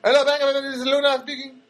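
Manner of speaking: high-pitched mock thick accent; exaggerated thick-accented bank greeting; Luna, thick accent. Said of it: "very thick accent".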